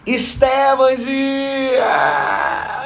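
long drawn-out shout; calling out. Real, not acted. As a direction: belt out the greeting. Host calling out greeting on live.

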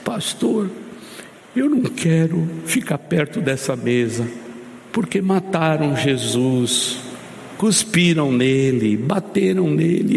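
a small child's voice, lightly voiced, with a touch of sobbing; tearful little girl. Pastor retells a crying girl at the communion table, tearful child voice. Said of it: "E ela com lágrimas, com soluço".

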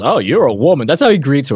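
ordinary delivery

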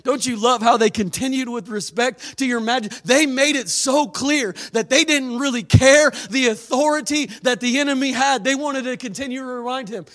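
ordinary talk

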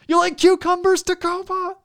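high, loud arena call; rock star shout. Pretend. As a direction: hype the crowd like a frontman. Continuing the rock star bit.

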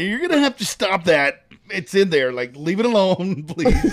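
pained plea. Retold or imitated. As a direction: beg her to stop, half laughing. Retold pained plea to nurse.

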